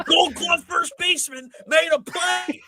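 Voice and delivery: high-pitched hammed-up gushing; sarcastic shrieked play-by-play; mock announcer amazement. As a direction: laugh and gush like an overexcited commentator. Mocking the gushing TV play-by-play call.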